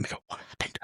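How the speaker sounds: whispering in mock disbelief; stunned whisper. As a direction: mouth it under your breath to a colleague. Onlookers' stunned private question.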